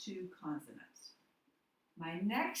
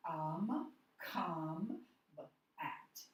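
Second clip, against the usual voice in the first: sounding out syllables; much slower than usual. Shown as a demonstration. Teacher reading a new word for students, sounded out in pieces. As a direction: break the word into separate pieces, COME and AT, with pauses between. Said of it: "So let's read this".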